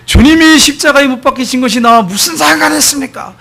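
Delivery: imitated loud, high-pitched outcry; loud shouting. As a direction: shout it out as a defiant challenge. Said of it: "이 친구가 큰 소리로".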